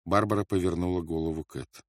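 ordinary delivery

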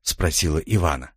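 usual speaking voice

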